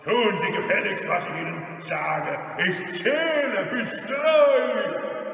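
loud, commanding bully; forceful, impatient order; robber's shouted threat. Acted. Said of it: "rief der Räuber Hotzenplotz".

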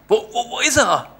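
high excited stammer; eager kid. Acted. Boy bursting into his room, high excited stammer. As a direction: burst in breathless with anticipation.

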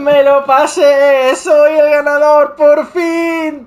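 mock grand gloating. Gloating after the win.

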